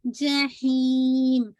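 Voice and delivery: जहीम slow and loud, ही held long; stretched ee. Shown as a demonstration. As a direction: draw out ही, closing जहीम on म at the end. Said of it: "ही को खींचिए". (जहीम में ही को लंबा खींचकर)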